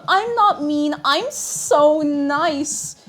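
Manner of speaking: high, loud, very slow protest; mock innocent. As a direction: overdo sounding nice. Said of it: "shouldn't lead with".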